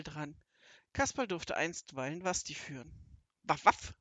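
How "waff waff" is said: two loud short woofs; imitated dog bark. A narrator's reading. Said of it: "bellte Wasti".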